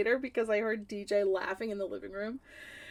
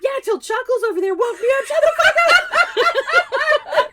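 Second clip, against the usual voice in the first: high, loud tirade building to scream; hammed-up furious shout; 14 semitones higher, 10 dB louder than usual. Wife yelling at laughing friend, screaming outrage. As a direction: explode in mock rage.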